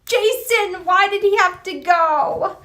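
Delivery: high, slow, drawn-out wail of grief; hammed-up grieving fan; mock lament. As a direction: plead to the sky with a high, slow voice. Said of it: "but I'm like".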